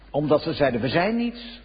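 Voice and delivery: ordinary delivery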